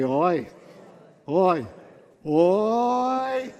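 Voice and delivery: oi with feeling. Shown as a demonstration. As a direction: build the groan to a long wail. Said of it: "with feeling".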